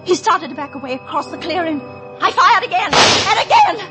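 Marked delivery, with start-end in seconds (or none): 2.2-3.8 loud, frantic narration of gunfire; intense recounting. Acted. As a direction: relive the shots, voice surging. Relives firing the gun.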